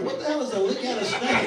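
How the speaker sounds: loud, high incredulous question; baffled outburst. Retold or imitated. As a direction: act out total bafflement, loudly. His baffled reaction, retold.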